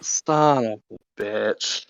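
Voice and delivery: slow, low, quiet curse; low grumble. Acted. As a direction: mutter it in defeat. Monk Lucas reacts, annoyed.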